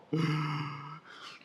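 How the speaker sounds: sharp mock gasp of horror; shocked gasp. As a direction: gasp as if appalled. Student shocked by the bell.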